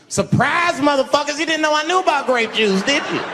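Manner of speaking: high, loud gloating shout; triumphant. Real, not acted. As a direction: crow it like a big reveal.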